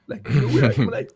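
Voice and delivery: ordinary delivery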